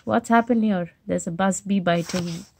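ordinary delivery

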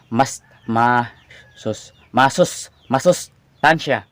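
sounds out masustansya slowly; slow careful saying. Shown as a demonstration. Tongue-tied vlogger slowly sounds out the word. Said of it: "Unti-unti lang nating bigkasin".

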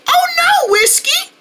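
very high, worried cry; alarmed. Acted. Friend hearing the cat hurt his arm, high alarmed cry.

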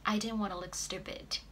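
normal talking voice

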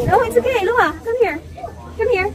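high gentle praise and coaxing. Real, not acted. Coaxing child across heights.

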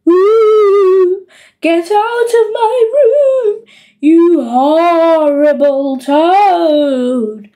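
long, drawn-out haunting wail; ghostly moan. Acted. Henry haunting the robbers out of his room, ghostly moan. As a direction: moan it very slowly like a spooky ghost. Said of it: "he would moan".

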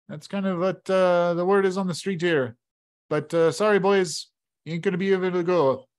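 chuckling innkeeper delivering bad news. Innkeeper warns the boys nobody leaves town, folksy and grim. Rourke on sacrifices.

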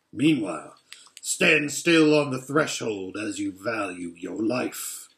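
low, menacing guard wolf; a wolf questioning an intruder; wolf's voice. Acted. Wolf threatens Edmund.

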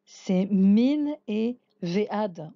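min and ve'ad said slowly, syllable by syllable; Hebrew words slowly. Shown as a demonstration. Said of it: "deux mots que vous connaissez pas".

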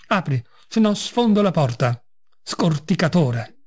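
ordinary delivery, nothing marked

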